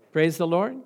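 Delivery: ordinary talk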